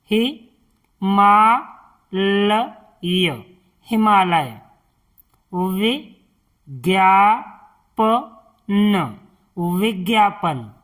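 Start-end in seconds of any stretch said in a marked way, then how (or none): 0.1-3.4 "हे, मा, ल, य" as separate sounds with pauses; syllable by syllable. Shown as a demonstration. Teacher teaching pronunciation to learners, spelling out "हे, मा, ल, य". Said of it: "इनका उच्चारण करना सिखाएंगे". 5.5-9.2 "वि, ज्ञा, प, न" slowly, each sound apart; syllable by syllable. Shown as a demonstration. Teacher teaching word reading, spelling out "वि, ज्ञा, प, न". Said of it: "इनका उच्चारण करना सिखाएंगे".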